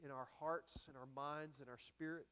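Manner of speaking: soft, hushed prayer voice. Acted. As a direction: pray quietly and reverently before the sermon. Pastor's closing prayer.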